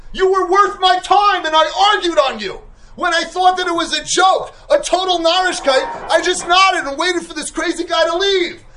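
high, loud, forceful retort; booming scolding rabbi; rabbi's sharp rebuke. Retold or imitated. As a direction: tell him off like a stern old rav. Brisker Rav rebukes the author.

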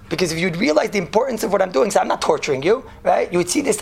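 ordinary delivery

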